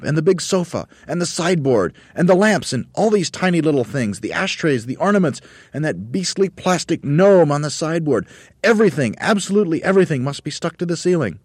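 high, loud excited list; Mugglewump shouting. A narrator's reading. Monkey leader listing furniture, shouting. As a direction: shout item after item, frantic. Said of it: "shouted Mugglewump".

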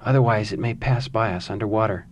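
usual speaking voice

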